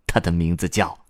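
ordinary delivery, nothing marked

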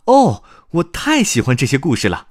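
put-on high, loud little boy voice; excited. Little Langlang loves grandpa's stories, excited rush. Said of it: "小朗朗兴奋地说".

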